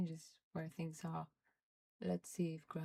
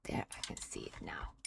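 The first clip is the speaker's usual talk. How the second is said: whispered relief; faster than usual. Real, not acted. Whispering relief finding button.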